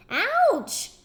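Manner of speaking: loud, high yelp of pain; pained scream. Acted. Storyteller to children, collector woman yelps loudly in pain. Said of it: "screamed the collector woman".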